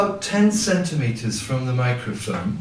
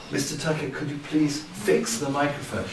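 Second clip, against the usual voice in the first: softer aside to the technician; discreet polite request; 2 semitones lower, 8 dB quieter than usual. Acted.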